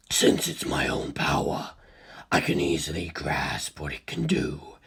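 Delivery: calm inhuman curiosity; low, slow, quiet voice of a detached monster; cold ancient being. A narrator's reading. Cars voice.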